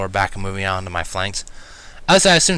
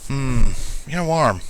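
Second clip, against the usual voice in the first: raspy mutter; 4 semitones higher, much slower than usual. A narrator's reading. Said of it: "muttered quietly and in a raspy tone".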